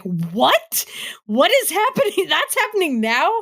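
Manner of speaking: high-pitched incredulous squeal; re-enacted stunned outburst, ending in a laugh; mock yelling. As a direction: yell it in disbelief at the computer, pitch shooting way up. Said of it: "yelling at my computer".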